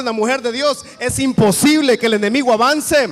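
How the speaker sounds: loud, building declamatory sermon shout; fervent Pentecostal preacher at full volume; full-force preaching. Real, not acted. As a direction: build to a thundering, relentless proclamation.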